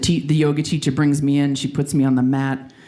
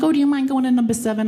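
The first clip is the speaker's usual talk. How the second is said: high mimicking woman; 10 semitones higher, faster than usual. Quotes woman asking to switch.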